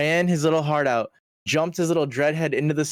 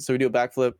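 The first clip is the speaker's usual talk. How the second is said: ordinary talk, nothing marked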